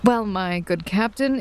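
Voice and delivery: normal talking voice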